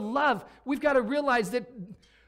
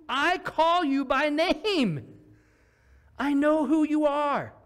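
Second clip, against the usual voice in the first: earnest God voice; 7 semitones higher, 5 dB louder, much slower than usual. Pretend. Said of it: "and he says".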